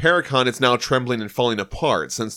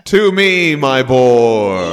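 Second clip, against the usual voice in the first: loud, drawn-out heroic call; booming theatrical summons; 2 semitones higher, 9 dB louder, much slower than usual. Acted. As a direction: call out grandly to your steed. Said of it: "So he calls his surfboard".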